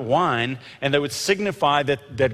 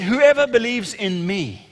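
high, slow proclamation, moderately raised; 7 semitones higher, slower than usual. Acted. Jesus proclaiming to the festival crowd, raised voice. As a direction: call it out high and drawn out. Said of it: "This was at the top of his voice".

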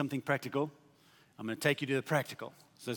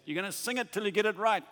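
higher, quick heckling call; heckler from the back; 7 semitones higher, 3 dB louder, faster than usual. Retold or imitated. Audience member heckles the tired opera singer, high quick call. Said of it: "a voice from the back said".

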